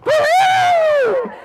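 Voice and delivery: long, high trumpet blast; elephant trumpet. A narrator's reading. Storyteller leading listeners as elephant, trumpeting. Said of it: "And he trumpeted".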